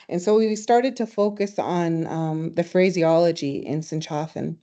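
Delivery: ordinary talk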